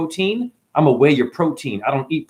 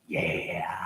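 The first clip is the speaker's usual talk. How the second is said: whisper; 6 semitones lower, 13 dB quieter than usual. Acted. Whispered aside after rant.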